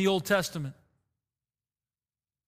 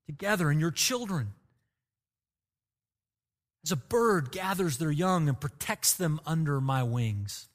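impassioned plea; 2 semitones lower than usual. Retold or imitated. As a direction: plead gently, like a grieving father. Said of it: "That was his impassioned plea".

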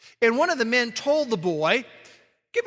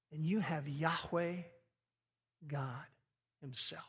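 hushed awe; 5 semitones lower, 12 dB quieter than usual. Acted. Awed climax on God's name.

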